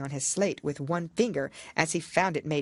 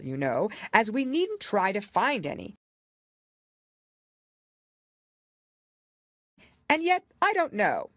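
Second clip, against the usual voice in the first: higher, louder royal pronouncement; pompous king; 5 semitones higher, 3 dB louder than usual. A narrator's reading. King explaining the handwriting to the jury, pompous. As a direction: declare it like a self-important king.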